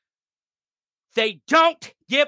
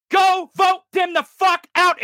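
higher, shouted command; angry shout; 6 semitones higher than usual. Real, not acted. Vlogger ordering viewers to vote, angry shout.

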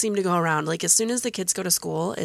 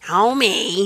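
rough Marge Simpson rasp; a rasp like Patty and Selma; gravelly voice; 2 semitones higher, 6 dB louder than usual. Retold or imitated. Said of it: "with that gravelly voice".

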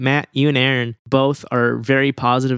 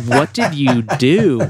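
drawn-out, louder mock disbelief; incredulous; 2 semitones higher, 4 dB louder, slower than usual. Podcaster ribbing a man whose Xbox got taken, incredulous. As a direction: stretch it out in amused incredulity.

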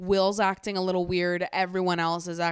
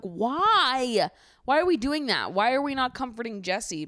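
exasperated protest; 5 semitones higher than usual. Acted. Viewer protesting everyone comforting the wrong person, exasperated. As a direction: demand an answer in exasperation. Exasperated outburst at group's reaction.

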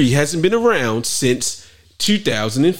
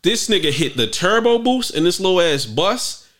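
normal talking voice

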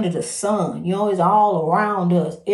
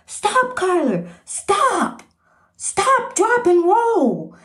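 high, urgent shouted commands; hollering; 9 semitones higher, much slower than usual. Retold or imitated. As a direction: bark it out high like a kid drilling the cat. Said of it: "And he hollering".

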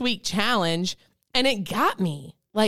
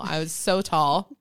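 ordinary talk

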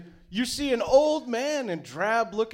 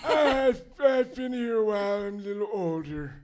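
put-on struggling old man; 3 semitones higher, 5 dB louder than usual. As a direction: push each word out like a tired old man. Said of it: "really struggling to get those words out".

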